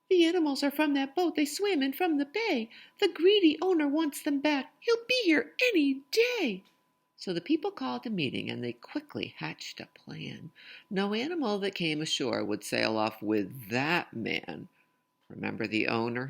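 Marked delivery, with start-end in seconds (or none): 0.1-6.6 much higher, louder, quick little messenger voice; excited messenger. Acted. Messenger Little Red bursting in to warn the town, high excited voice. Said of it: "Little Red the messenger came running". 7.2-14.7 lively, sing-song storytelling voice; picture book read-aloud. Acted. Reading a rhyming picture book aloud to children, lively sing-song.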